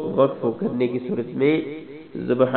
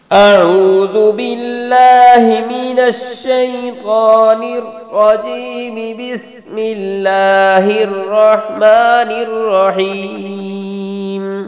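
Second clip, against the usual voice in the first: very slow, much higher and louder chanted recitation; full-voiced melodic Quran recitation; 9 semitones higher, 8 dB louder, much slower than usual. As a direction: sing the surah out in full tajweed melody.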